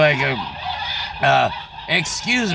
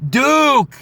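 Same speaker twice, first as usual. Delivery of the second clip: higher, clipped mock woman's answer; 10 semitones higher, 5 dB louder than usual. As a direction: toss the name off lightly. Woman answers her college.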